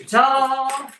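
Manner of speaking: loud, high showy ta-da; triumphant. Real, not acted. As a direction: announce it like a magic reveal. Presenting the finished heart.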